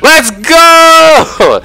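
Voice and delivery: very high, loud drawn-out cheer; excited victory yell. Real, not acted. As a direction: belt out a triumphant cheer. Celebrating in game.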